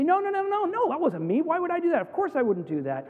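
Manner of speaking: high-pitched, protesting string of denials; acted child doubling down, high and insistent; adamant denial. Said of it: "you become more adamant".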